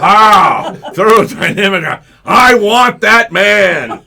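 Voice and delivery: loud, high swooning cry; hammed-up ecstatic yell of desire; mock turned-on woman. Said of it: "she's turned on about that".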